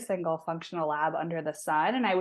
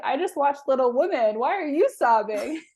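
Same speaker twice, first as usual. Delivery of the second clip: higher and louder, mimicking her mom's baffled question; imitating mom; 7 semitones higher, 4 dB louder than usual. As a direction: play the mom, half laughing, tossing the question back. Retelling mom's reply on FaceTime.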